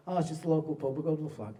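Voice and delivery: softly attempted Bolton accent, badly done; half-hearted imitation of a Bolton local; casual, poor Bolton accent; terrible Bolton accent. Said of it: "This is a terrible accent".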